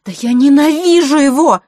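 high, loud outburst of rage; furious. A narrator's reading. Vasilisa rages at her brother about their father, furious. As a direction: explode with hatred. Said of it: "в бешенстве выдохнула она".

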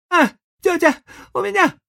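high, loud mock-suffering whine; hammed-up pain. A narrator's reading. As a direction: overplay the pain. Tom feigning pain.

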